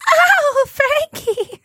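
high, breathless swoon over a crooner; swooning fifties girl. Acted. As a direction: sigh it like a smitten bobby-soxer. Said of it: "any girl in the fifties with regards to [name]".

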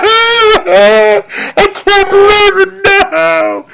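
high, slow, hammed-up sobbing; fake crying. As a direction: wail it out like forced tears. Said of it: "Just act like a".

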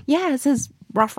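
put-on high eager kid voice; excited little kid. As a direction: chirp it like an excited toddler. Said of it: "They're like".

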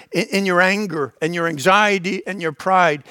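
ordinary delivery

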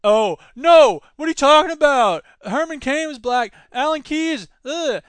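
loud, indignant, pitch up; huffy brush-off of the charge; mock defensive voter. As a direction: sputter like a voter caught out. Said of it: "is that".

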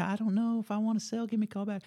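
put-on high, soft voice of a sweet old lady. Imitating a sweet old landowner on the phone, high and soft. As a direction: lightly play a gentle old caller. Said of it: "sweet old Betty June that call you and say".

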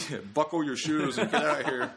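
high, loud mock officer's order; old-time officer. Comic riffing on an eighteen seventy-five officer, hammed-up command. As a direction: play an old-time cop giving orders. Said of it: "This is an eighteen seventy-five officer".

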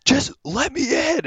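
whine-demand to be let in; mock angry demand. Dog owner getting mad at the door, a hammed-up angry demand. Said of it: "getting all mad".

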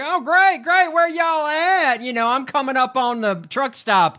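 trucker voice. Retold or imitated. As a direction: ham up an eager trucker. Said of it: "this guy was like".

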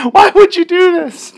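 very high, whiny comic protest; hammed-up plaintive cry; mock heartbroken wail. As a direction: squeal it like a betrayed victim. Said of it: "you're just like".

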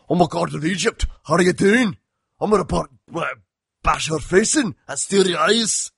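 imitating Gerard Butler's Scottish accent, loosely; mock Scottish accent. Movie critic mocks actor's Scottish accent in review, hammed-up Scottish. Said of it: "doing his full-on Scottish accent".

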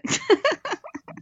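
high giggling laugh; amused high-pitched laughter. Real, not acted. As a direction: burst into a high chuckle. Laughs at the confusion.

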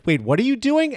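lightly acted confused double take; mock bewilderment. Podcaster voicing the actress's reaction to the stunt, mock bewilderment. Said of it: "gets this look on her face like".

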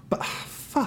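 breathy defeated whisper; whispered curse. Acted. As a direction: mutter it under the breath. Father collapses after son runs.

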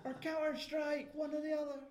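very high, drawn-out mock scream. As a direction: yell slowly at the screen like a frantic viewer. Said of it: "wanting to scream at the T V".

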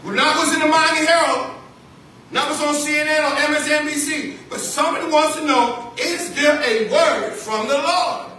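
preacher building up at the pulpit; preaching rise. Acted. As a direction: lift it up to the congregation. Preaching climax.

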